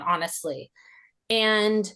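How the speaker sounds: usual speaking voice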